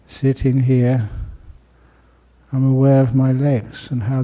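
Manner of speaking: calm, unhurried meditation guide with long pauses; guided meditation. Real, not acted. Teacher guiding listeners to close their eyes and relax, calm meditation voice. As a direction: ease listeners into stillness, pausing between phrases.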